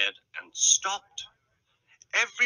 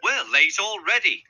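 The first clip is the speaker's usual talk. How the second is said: higher-pitched impatient complaint; grumbling; 8 semitones higher, 4 dB louder than usual. A narrator's reading. Late passengers complaining, grumbling impatiently. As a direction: grumble about the delay. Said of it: "grumbled the passengers".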